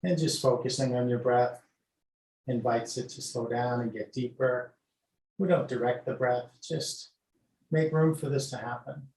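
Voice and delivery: guided meditation. Acted. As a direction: ease the class into noticing the breath. Leads breath meditation.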